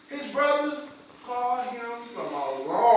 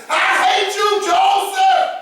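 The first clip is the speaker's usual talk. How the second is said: high, loud furious yell of the name; angry shout; 11 semitones higher, 8 dB louder, much slower than usual. Acted. Jealous brother screaming his hatred at Joseph.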